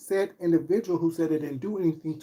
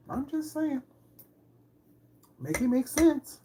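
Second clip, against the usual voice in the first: sarcastic jab; 9 semitones higher than usual. Real, not acted. Mocking rhetorical jab at critics.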